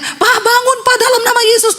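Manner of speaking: high-pitched urgent calling; acted wife's cry. Wife calls collapsed husband awake, high-pitched cry. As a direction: cry out high to rouse him. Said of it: "Istrinya teriak".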